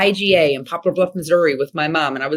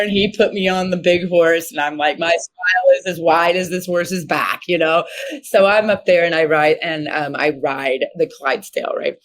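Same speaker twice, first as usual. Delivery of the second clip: usual speaking voice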